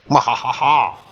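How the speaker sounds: hammy villain cackle; evil laugh. Acted. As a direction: cackle like a panto baddie.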